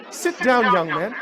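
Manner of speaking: lightly voiced commanding music teacher; teacher's curt command. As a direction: order a pupil to sit. Retells the stern teacher's command.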